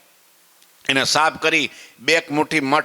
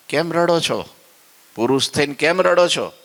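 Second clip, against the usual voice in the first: wife's scolding question; 5 semitones higher than usual. Acted. Wife questions crying husband.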